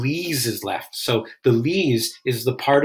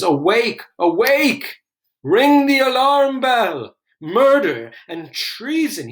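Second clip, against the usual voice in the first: alarmed yell; 11 semitones higher, 5 dB louder, slower than usual. Acted. Macduff rousing the house after finding the murdered king, shouting. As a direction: shout it high and loud, slowing on the alarm. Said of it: "He's yelling".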